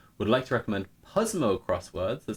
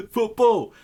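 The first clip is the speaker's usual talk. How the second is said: "Football!" called out much higher and louder, falling; proud shout; 13 semitones higher, 8 dB louder than usual. Shown as a demonstration. Streamer proudly shouting "Football!" for everyone to understand. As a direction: shout the word "Football!" triumphantly. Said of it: "I want to just be able to go".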